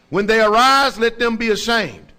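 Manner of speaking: full-force preaching of the verse; thundering scripture. Acted. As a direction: boom it over the congregation. Reading the psalm at full force.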